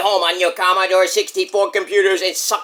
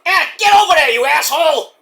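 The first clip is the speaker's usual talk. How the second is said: high, loud yell of "get over there"; angry shout; 9 semitones higher, 5 dB louder than usual. Pretend. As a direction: bark the order at the old-timers. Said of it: "You didn't have to say asshole".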